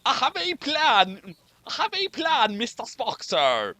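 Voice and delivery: put-on a light stab at a thick Scottish accent; half-hearted Scottish engineer voice. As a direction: try out a Scotty brogue. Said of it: "can anyone do a very thick Scottish accent".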